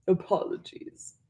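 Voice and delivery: soft, breathless half-hearted apology; mild apology. A narrator's reading. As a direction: say it mildly, not meaning it. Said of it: "Glurk said mildly".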